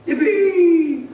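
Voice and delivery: loud, high cry of joy; joyful whoop. Acted. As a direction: whoop it out with pure delight. George overjoyed, running through town.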